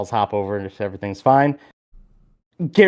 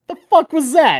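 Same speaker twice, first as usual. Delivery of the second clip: much higher, baffled "The fuck was that?"; stunned disbelief; 5 dB louder than usual. Retold or imitated. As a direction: react as if you can't believe what just happened. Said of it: "I'm like".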